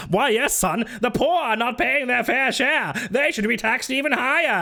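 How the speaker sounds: high, hammed-up agreeing voice; mock pundit's pal. Mimicking pundit pals agreeing on taxing the poor, hammed-up. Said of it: "Ben Shabibo with his pals".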